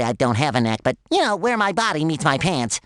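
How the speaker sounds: ordinary talk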